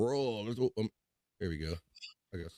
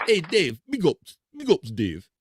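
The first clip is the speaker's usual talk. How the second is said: put-on Jamaican accent; 7 semitones higher, 4 dB louder than usual. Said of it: "She's got a Jamaican accent".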